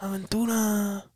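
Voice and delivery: high, soft, slow drawn-out impression; singer impression. Showing how the singer talks, high slow impression. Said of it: "he talks like this".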